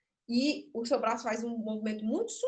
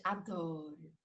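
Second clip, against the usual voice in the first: dreamy adoring; much slower than usual. Shown as a demonstration. Teacher voicing the puppet's dreamy adoring line, slow. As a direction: sigh it out lovingly, very slowly. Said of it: "ela vai dançar o jeito que você tá falando".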